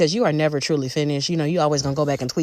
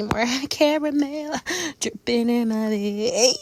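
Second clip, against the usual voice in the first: slow, higher, laughing flirty purr; playful sultry tease; 6 semitones higher, slower than usual. Pretend. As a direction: drag it out like a saucy joke.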